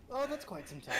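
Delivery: high-pitched, laughing parody; mock echo. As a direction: lightly mimic it in a high voice. Mocking echo of player's line.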